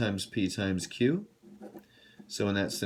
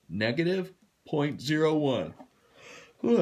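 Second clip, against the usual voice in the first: higher-pitched words stretched through a yawn; yawning while talking; 6 semitones higher than usual. Real, not acted. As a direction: talk through an oncoming yawn. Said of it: "I'm yawning".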